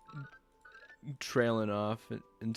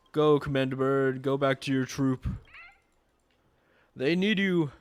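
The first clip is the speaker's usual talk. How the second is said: rallying cry; 2 semitones higher, 5 dB louder than usual. Pretend. Rallying a bird commander.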